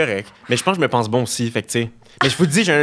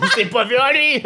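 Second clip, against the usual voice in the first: laughing mother; 10 semitones higher than usual. Retold or imitated. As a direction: crack up mid-sentence. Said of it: "elle était comme". (mère qui rit aux éclats)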